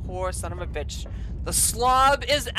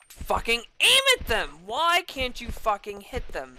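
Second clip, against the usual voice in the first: ordinary delivery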